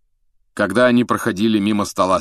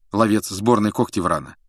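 plain reading voice

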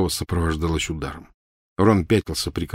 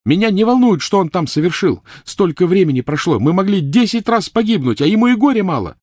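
put-on furious girl; 12 semitones higher, 5 dB louder than usual. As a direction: spit each word out with a blow. Hermione shouting.

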